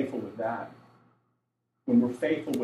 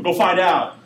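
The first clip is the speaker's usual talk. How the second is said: louder, lightly stern dad order; dad getting on kids; 3 semitones higher, 7 dB louder than usual. Acted. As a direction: send the kid off like a dad. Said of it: "like he's getting on 'em".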